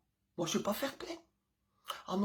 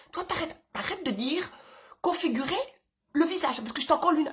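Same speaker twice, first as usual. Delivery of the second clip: loud, higher, fast and furious telling-off; angry scolding; 8 semitones higher, 6 dB louder than usual. Retold or imitated. Mother threatening her kid with a slap, loud angry scolding. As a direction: snap at the kid like you're about to lose it.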